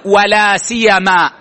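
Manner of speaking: لا سيما slow, the ي light; teacher models لا سيما with a single ي; pronunciation demo. Shown as a demonstration. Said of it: "التشديد والتخفيف". (لا سيما بتخفيف الياء)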